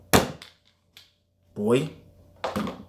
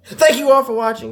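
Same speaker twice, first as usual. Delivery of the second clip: much higher and louder thanks; high excited thanks; 14 semitones higher, 6 dB louder than usual. Real, not acted. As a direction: burst out with thanks.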